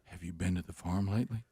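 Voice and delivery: low, hushed sinister voice; creepy horror whisper. Pretend. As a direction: read it like a horror trailer. Reading the creepy trailer line.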